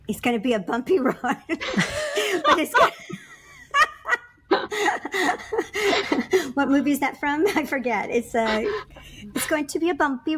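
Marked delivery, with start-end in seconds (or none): 1.2-6.5 laughing. Pretend. Sings movie line, laughing.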